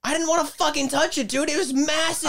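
high-pitched, exasperated protest; incredulous outburst. Real, not acted. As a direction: defend yourself in a high, worked-up rush. Defends himself against listener's question.

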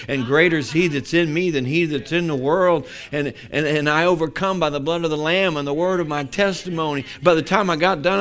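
loud, rhythmic string of declarations; full-force preaching. Acted. Preacher telling what he declared in his prayer closet, forceful preaching. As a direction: hammer out each confession with rising force.

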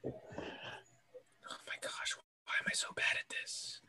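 frustrated whisper under breath; whispered frustration. Real, not acted. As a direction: whisper it to yourself.

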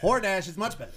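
high, loud comic whine; Neil Hamburger voice. Acted. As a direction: lounge comic impression. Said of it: "Neil Hamburger".

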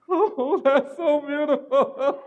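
high, slow mock gush through laughter; gushing laughing reply. Teasing the congregation with a gushing, laughing reply. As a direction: overdo the sweet reaction, laughing.

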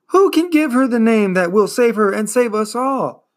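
high, loud desperate plea; despairing cry. A narrator's reading. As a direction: plead loudly in despair. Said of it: "cried Atreyu in despair".

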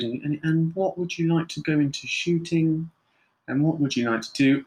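lightly voiced therapist questioning; psychotherapist voice. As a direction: probe politely, like a therapist in session. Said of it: "kind of psychotherapist voice".

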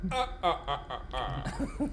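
slow, laughing vampire count; Dracula laugh. Pretend. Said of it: "No, that's Dracula".

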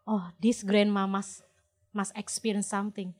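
ordinary delivery

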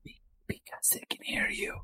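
soft unvoiced whisper. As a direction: whisper as if being overheard. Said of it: "Why are we whispering now?".

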